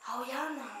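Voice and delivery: low, hushed invitation; soft, timid acted murmur; very soft shy bride. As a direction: play a bashful bride who barely raises her voice. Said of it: "ती एकदम हळू बोलते".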